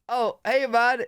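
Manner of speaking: mock cheery compliment from a passerby; friendly American. Voicing a friendly American stranger.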